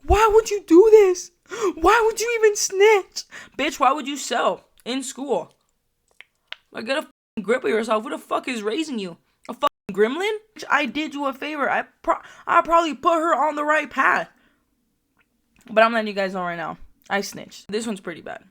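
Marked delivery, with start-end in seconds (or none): 0.0-3.2 loud, very high mock wail; hammed-up tearful shriek, slow and drawn out; imitated crying. Storyteller imitates a crying girl confronting the snitch, wailing. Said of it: "one of the girls was crying". 3.6-14.3 higher-pitched, scolding comeback; acted angry retort. Storyteller replays scolding the girl who sold in school, indignant.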